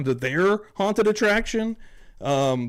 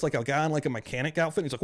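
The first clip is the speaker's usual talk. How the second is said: ordinary talk, nothing marked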